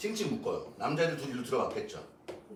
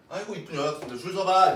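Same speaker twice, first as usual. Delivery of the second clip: fond teacher; 4 dB louder than usual. Retold or imitated. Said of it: "이렇게".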